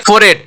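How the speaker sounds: "फोरहेड" said "for-ed", no h; correct pronunciation. Shown as a demonstration. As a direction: join "फोरहेड" with the h silent. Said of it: "इसका pronunciation क्या करेंगे". ("फोरहेड" को फ़ोरेड बोलें)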